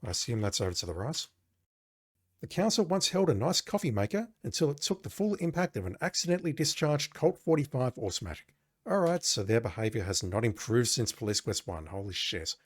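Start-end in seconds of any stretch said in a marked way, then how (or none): 2.4-8.5 higher-pitched storyteller read; game narration. Acted. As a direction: narrate it like an adventure game text. Reads game text aloud.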